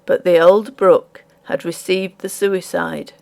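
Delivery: plain reading voice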